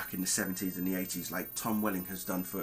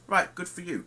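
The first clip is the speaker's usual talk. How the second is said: high, louder mock praise; sarcastic; 10 semitones higher, 5 dB louder than usual. Real, not acted. Reviewer answers people who predict the ending, sarcastic. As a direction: congratulate them sarcastically, pitch jumping up.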